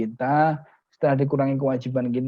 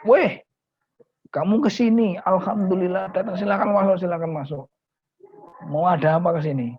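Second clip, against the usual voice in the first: hearty welcome; 6 semitones higher than usual. Acted. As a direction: greet the old friend with delight. Rich friend greets the poor kiai.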